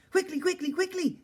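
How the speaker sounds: very high, loud squeaky creature; squeaky little yell. A narrator's reading. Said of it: "it yelled at me in its squeaky little voice".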